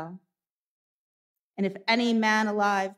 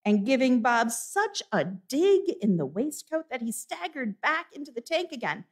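ordinary delivery

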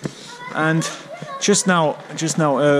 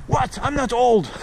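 higher, affronted retort; mock offence; 6 semitones higher than usual. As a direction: play someone insulted about their age. Acts out an offended old woman.

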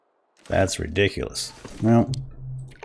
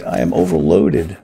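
ordinary talk, nothing marked

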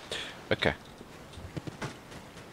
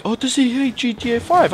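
mock viewer question; 5 dB louder than usual. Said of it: "a lot of you guys were like".

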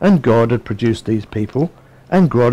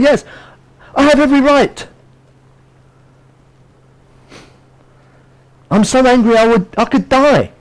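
indignant outburst; 11 semitones higher than usual. Acted. Jonah protesting to God, high and hammed-up angry. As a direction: work up into a tantrum. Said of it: "he's speaking back to God".

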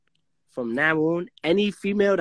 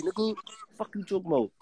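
normal talking voice

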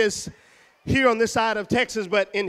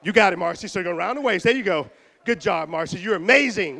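ordinary delivery

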